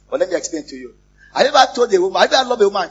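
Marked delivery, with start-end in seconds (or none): 1.3-2.9 louder, higher challenge; preacher challenges the men in the pews; pressing shout. Real, not acted. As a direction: drive the question at them.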